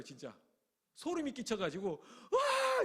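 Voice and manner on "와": high, loud startled "wah!"; startled crowd cry. Retold or imitated. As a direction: shriek of shock. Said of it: "이랬어요". (깜짝 놀라 높게 외치는 "와!")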